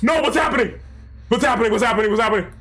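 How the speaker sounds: high, rapid-fire panicked questions. Real, not acted. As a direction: panic as it all goes wrong. Panics at sudden turn in game.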